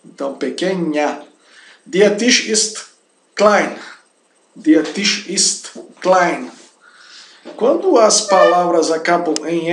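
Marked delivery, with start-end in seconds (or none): 1.9-6.5 slow, spaced words with "klein" set apart; slow German model. Shown as a demonstration. Teacher modelling "the table is small", slow careful German. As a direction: enunciate each German word for learners.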